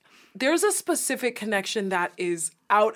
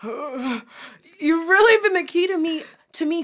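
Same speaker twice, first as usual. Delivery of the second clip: tearful; 6 semitones higher, 5 dB louder, slower than usual. Real, not acted. Emotional thanks after crying.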